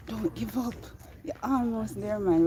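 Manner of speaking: a stranger's upbeat call to keep climbing; cheering hikers. Retold or imitated. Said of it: "they'll say".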